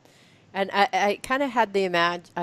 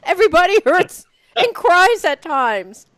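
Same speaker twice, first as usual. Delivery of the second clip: higher and louder sing-song recital of the book; hammed-up pixie wisdom; 10 semitones higher, 9 dB louder than usual. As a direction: proclaim the pixie's wisdom with playful exaggeration. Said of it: "And you can say".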